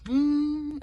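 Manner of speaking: high impression hum; questioning high hum in a put-on voice; Trump. Finding a starter phrase, high Trump-style hum. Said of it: "is my starter phrase for Trump".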